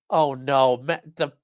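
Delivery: normal talking voice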